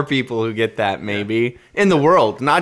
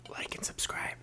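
subliminal whisper; 21 dB quieter than usual. Pretend. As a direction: breathe it almost silently. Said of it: "Maybe I should just subliminally".

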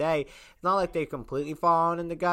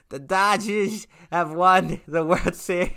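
slow, louder announcer call; 3 semitones higher, 5 dB louder, slower than usual. Retold or imitated. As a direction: boom it like a broadcaster. Mimics the trophy speech.

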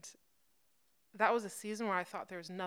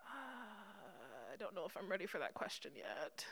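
very soft, reluctant murmur; hesitant reply; 14 dB quieter than usual. Retold or imitated. Believer answering God's hard question, quietly hesitant. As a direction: shrink from the question, hushed.

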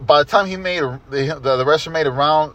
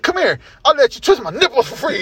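higher, teasing challenge; mock taunt; 8 semitones higher than usual. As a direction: tease like a cocky showman. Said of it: "he was like".

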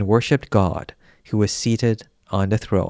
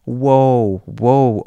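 loud wailing woe; a grieving crowd's lament, read aloud; mournful cry; 5 dB louder than usual. A narrator's reading. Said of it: "with weeping and mourning cry out".

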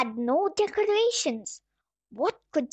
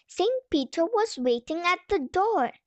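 ordinary delivery

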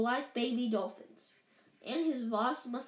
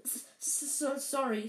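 sobbed; 2 semitones higher than usual. A narrator's reading. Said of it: "sobbed Hagrid".

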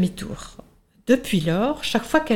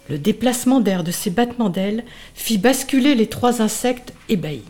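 ordinary delivery

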